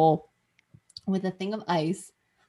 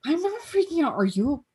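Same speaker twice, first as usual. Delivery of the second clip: put-on defensive boyfriend; 7 semitones higher than usual. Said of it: "he's like".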